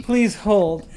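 announcing please hold; like a phone hold recording; phone menu voice. Pretend. Mock hold message.

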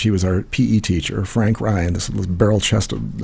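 ordinary talk

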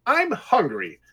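high-pitched, airy fib; innocently put-on claim; smooth lie. A narrator's reading. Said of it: "she lied smoothly".

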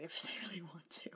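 a giggly whispered joke; whispered aside. Real, not acted. Joking to her cohost about the name, whispered with a laugh. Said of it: "whispered aside".

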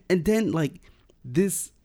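normal talking voice

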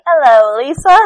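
put-on loud, odd Tommy Wiseau accent. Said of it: "if you think any accent will work".

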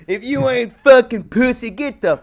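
hammed-up angry Bruno guy; mock shout. Quoting Bruno scene.

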